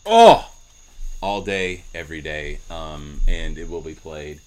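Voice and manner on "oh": a sudden high, loud burst; excited exclamation. Real, not acted. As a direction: blurt it out, thrilled. Reacts to seeing the Stone.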